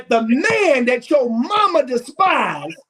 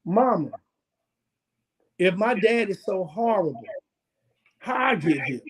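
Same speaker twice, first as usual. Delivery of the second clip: a hint of a hurt child, softer; child questioning mama, softly; quiet child voice; 6 semitones lower, 7 dB quieter than usual. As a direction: ask it low and small like a confused kid. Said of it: "that child says".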